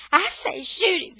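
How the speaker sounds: high, loud angry demand; furious insistence. Acted. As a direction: insist in fury. Mother insists angrily.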